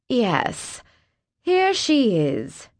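slow, high, dismissive sneer. A narrator's reading. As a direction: drawl it out with contempt. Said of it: "The sneer in Chloe's tone".

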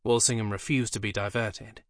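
ordinary delivery

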